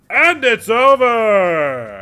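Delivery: slow, loud, very drawn-out final call; announcer call. Acted. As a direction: announce the knockout to the whole arena. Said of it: "the announcer goes".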